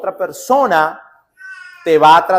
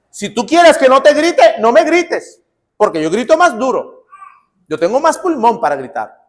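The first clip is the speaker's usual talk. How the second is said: mock aggressive threat; 6 semitones higher than usual. Preacher mimicking a quarrelsome person, mock shouting threat. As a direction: throw out the threat like a brawler. Quoting a bully who shouts.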